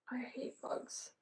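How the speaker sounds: hushed whisper after killing a bug; whispered aside. Real, not acted. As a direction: whisper it, slowly. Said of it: "unvoiced aside".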